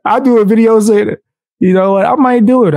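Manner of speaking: excited, pitch jumping high; hyped up. Real, not acted. Streamer hyping himself up to chat, excited. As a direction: pump yourself up about the stunt. Hyped about doing a video.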